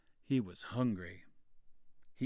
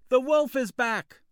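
high, loud alarm call; shouting; 14 semitones higher, 13 dB louder than usual. A narrator's reading. Boy fooling the villagers, shouting loudly. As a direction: cry out the false alarm. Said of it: "he shouted".

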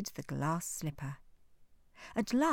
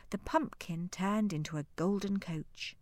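plain reading voice

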